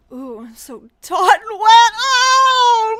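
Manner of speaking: loud, high hammed-up moan; overdone breathy tease; mock sultry moan. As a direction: camp up the lewd line. Reading a lewd comment.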